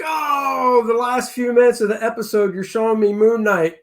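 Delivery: high, bright burst of fan excitement; excited outburst. Retold or imitated. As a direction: blurt it out like a thrilled fan. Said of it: "you're like".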